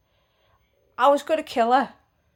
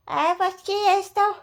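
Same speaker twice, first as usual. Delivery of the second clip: high, snotty mock retort; 9 semitones higher, 4 dB louder than usual. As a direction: sneer it back in a high voice. Mimicking neighbour's retort.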